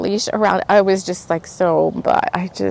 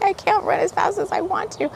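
high-pitched reenacted frustration; mad at self; 11 semitones higher, 6 dB quieter than usual. Runner failing to catch her dog, mock frustrated. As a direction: squeal out a mock complaint at yourself. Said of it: "I was getting so mad at myself".

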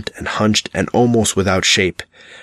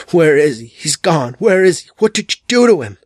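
rising, much higher, louder anguished cry; agonized howl; 7 semitones higher, 4 dB louder than usual. A narrator's reading. Trash Can Man howling for his lost master, rising anguished cry. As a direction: howl in mounting agony. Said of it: "the voice rose to an agonized howl".

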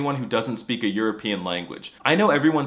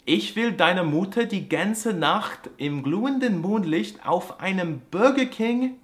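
slow, high, drawn-out German; German accent; 7 semitones higher, slower than usual. Acted. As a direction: drag it like a German lecture. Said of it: "put the verb at the end".

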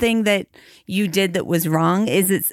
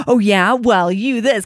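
louder, mock retort; mock defensive snap; 2 semitones higher, 4 dB louder than usual. Spouse defending back after an apology, mock huffy. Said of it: "be defensive back".

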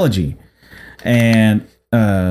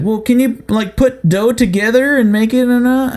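mock pushy customer; 8 semitones higher than usual.

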